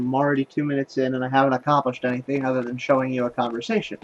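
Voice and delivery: ordinary talk, nothing marked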